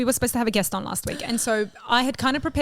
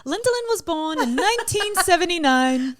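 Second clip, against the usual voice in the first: slow, grand higher intro voice; documentary narrator; 8 semitones higher, 3 dB louder than usual. Pretend. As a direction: present her like a biopic narrator. Mock biography introduction.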